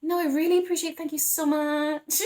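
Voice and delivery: politely squirm out of it; imitating a high, uneasy no thank you; awkward polite decline. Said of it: "as you kind of back out".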